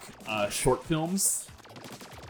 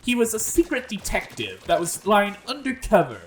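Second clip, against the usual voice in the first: higher-pitched, hammed-up British detective with transatlantic accent; Ted, transatlantic accent; 3 semitones higher than usual. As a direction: narrate in a comic transatlantic accent. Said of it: "I did this voice for Ted".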